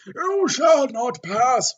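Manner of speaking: slow, loud, high booming wizard; Gandalf impression. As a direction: boom it out like a wizard at a bridge. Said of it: "Wrong person".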